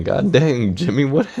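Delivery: comic indignant yelling through amusement; mock angry yelling. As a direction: play an old man's angry yell, hammed-up.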